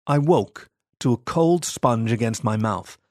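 plain reading voice

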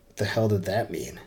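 ordinary delivery, nothing marked

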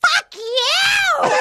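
hammed-up high shout at him; mock furious yelling. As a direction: scream it like a jilted woman. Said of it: "fucking enraged".

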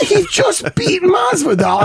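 slow, high laughing shout; exasperated shout. Acted. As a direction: spell it out in disbelief, laughing. Exasperated repeating himself.

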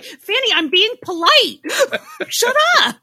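hammed-up "shut up" through laughter; imitating a hushing friend. Retelling her friend's look, a high, loud laughing mock hush. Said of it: "You gave me this look like".

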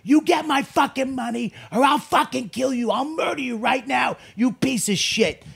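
high-pitched furious shout; snapping in rage. Retold or imitated. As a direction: act out a mobster's explosive threat. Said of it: "he just fucking snaps".

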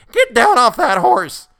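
very high, loud, slow command; furious order. A narrator's reading. Boy orders the colonel down, very high and loud. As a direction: bark it at the rider in boyish rage.

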